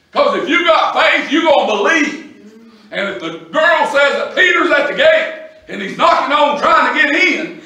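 high, driving preacher's cadence; full-force preaching. Real, not acted. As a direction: press each phrase on the congregation.

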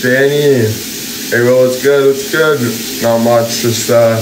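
talking through a toothbrush; while brushing teeth. Real, not acted. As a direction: mouth full of toothpaste. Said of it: "brushing my teeth right now".

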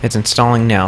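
normal talking voice

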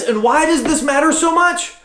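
fervent sermon at full pitch; passionate preaching. Acted. Preaching climax.

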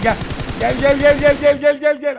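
high, loud repeated beckoning; loud taunting call. Real, not acted. Streamer baiting an enemy truck, loud taunting calls. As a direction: lure him in, shouting.

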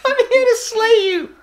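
high-pitched, laughing mock declaration; mimicking film line. Viewer echoing the film's threat for fun, high laughing mimicry.

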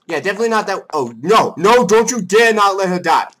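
indignant threat. Real, not acted. Player warning co-player not to let her die, indignant. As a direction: threaten your co-player at full volume.

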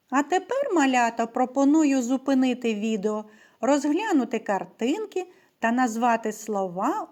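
usual speaking voice

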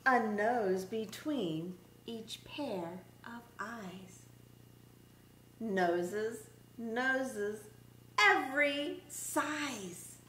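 lively, singsong picture-book read-aloud; reading to children. Acted. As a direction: read it like story time for little kids.

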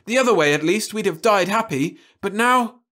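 horrified despair. Acted. Jim horrified, raging at Louise. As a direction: rail at her in horror.

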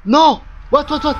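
high, loud cry of shock; alarmed shout. Real, not acted. Gamer hit by a scare in a horror game, alarmed shout.

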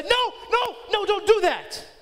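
sharp high alarmed No no; urgent rebuke. Retold or imitated. Parent yelling at child near socket, sharp alarmed rebuke. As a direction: jump in and stop the child cold. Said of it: "That's a rebuke".

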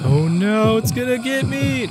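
hammed-up panicked wail, rising high; mock terror. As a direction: pretend you're about to be caught, loud and high. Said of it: "No, I'm just kidding".

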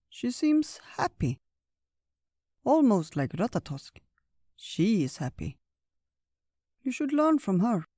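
put-on a fond old creature, pitched high; old bear voice. Old Bear comments on Tina.

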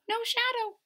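usual speaking voice

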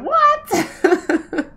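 high, startled what; surprised what. Retold or imitated. Reacting to sudden snowfall, high startled what. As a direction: react as if the snow just started.

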